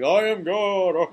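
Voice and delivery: slow, drawn-out mock divine voice; hammed-up booming God, stretching each word; deep-voiced God. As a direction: intone it like a pompous deity. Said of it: "Deep voice, yeah".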